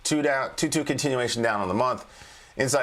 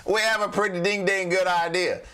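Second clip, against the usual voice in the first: playful emphasis; 8 semitones higher than usual. Real, not acted. Jokey correction after laugh.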